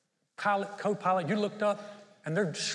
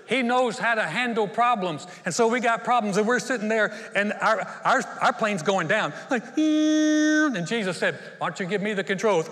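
loud, high fervent preaching; impassioned sermon delivery; full-force preaching; 5 semitones higher, 9 dB louder than usual. Acted. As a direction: preach it out to the congregation.

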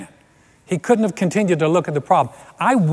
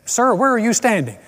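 mock on-the-scene news report; a hint of a serious TV correspondent; TV news reporter; 6 semitones higher than usual. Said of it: "we're talking over here to a man".

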